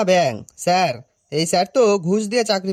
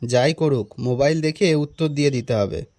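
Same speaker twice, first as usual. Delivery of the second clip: low-pitched talking to oneself; nervous aside; 4 semitones lower than usual. Acted. Teacher steels himself for a pupil's question, low aside. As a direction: keep it low, a private thought.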